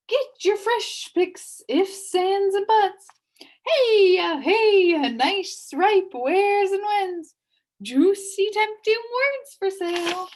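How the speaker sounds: high, loud hawking cry, slow and drawn out; loud market hawker. A narrator's reading. Merchant crying wares to the market crowd, loud and high. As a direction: sell your wares over the crowd's noise. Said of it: "merchant voices could be heard loudly advertising".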